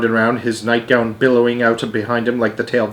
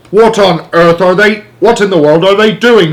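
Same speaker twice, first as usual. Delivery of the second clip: shouted; 8 semitones higher, 7 dB louder than usual. A narrator's reading. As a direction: bark it at the screen. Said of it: "shouted the President".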